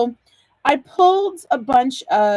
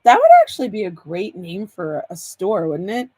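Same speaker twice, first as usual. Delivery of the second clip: ordinary talk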